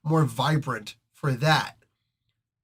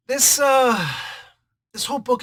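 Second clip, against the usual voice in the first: excited burst; 10 semitones higher, 6 dB louder than usual. Real, not acted. Excited outburst on the art.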